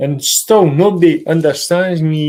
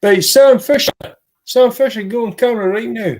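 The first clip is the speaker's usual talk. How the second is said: high, loud call to the dog; 6 semitones higher, 4 dB louder than usual. Real, not acted. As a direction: call his name out, higher and louder. Calling the dog on camera.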